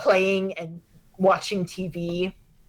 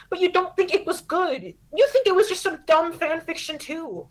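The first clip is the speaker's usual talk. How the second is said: high, hurt accusation; a teen sure her mom thinks it's dumb; lightly voiced wounded girl; sulky daughter; 9 semitones higher than usual.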